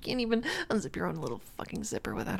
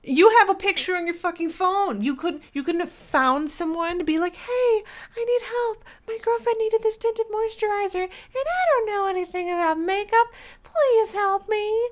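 high, loud mocking voice of a helpless boyfriend; mocking whiny boyfriend; 12 semitones higher, 8 dB louder than usual. Girlfriend mocking her boyfriend's helpless plea, shrill. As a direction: sneer it out as his pathetic plea.

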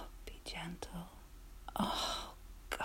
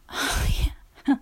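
ordinary talk

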